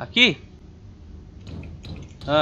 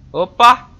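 loud, higher startled yelp; startled opa; 8 semitones higher, 11 dB louder than usual. Real, not acted. Player startled by a door opening, loud. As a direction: jump at the sudden door.

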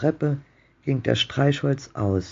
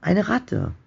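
higher-pitched, mildly frightened exclamation; a touch of fearful trembling, pitched up; 8 semitones higher than usual. A narrator's reading. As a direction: repeat it higher, as a nervous old man. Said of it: "sagte der Mann bebend".

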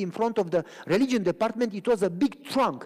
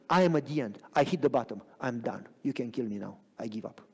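defeated surrender; 6 semitones lower than usual. Retold or imitated. A man telling God he is done, retold in defeat. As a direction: let it drop away as you give up. Testimony of hitting bottom.

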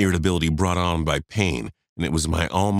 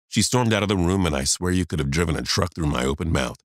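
usual speaking voice

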